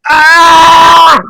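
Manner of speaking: loud, high anguished scream. Acted. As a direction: scream out in terror. Suspect cries out under interrogation.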